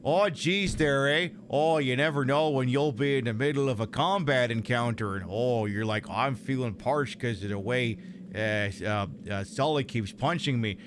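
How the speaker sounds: lightly put-on Canadian accent with eh. Gamer voicing his character, light Canadian accent. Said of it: "I should be doing this in a different accent".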